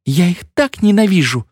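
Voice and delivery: high, vehement outburst; bride's fervent exclamation; impassioned cry. A narrator's reading. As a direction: blurt it out high with feeling. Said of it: "вскричала невеста".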